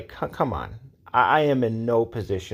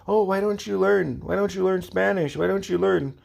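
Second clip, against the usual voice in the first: high-pitched mimicking of a nag; mock nagging; 9 semitones higher than usual. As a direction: imitate their nagging, pitched way up. Mimicking those who make her feel bad.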